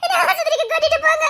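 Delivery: high, loud sobbing wail; wailing in pain. Acted. Pregnant wife telling her husband the pain is unbearable, wailing. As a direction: cry out each word as the pain hits.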